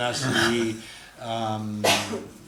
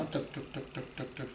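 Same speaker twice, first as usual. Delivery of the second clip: whispered clicking; 2 semitones higher, 10 dB quieter than usual. Retold or imitated. Imitating slow coaster clicks.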